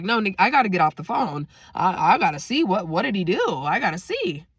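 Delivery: eager, insistent, repeating himself in a rush to know; all excited. Retold or imitated. Cousin itching to learn the jail reason, all excited. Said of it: "All excited".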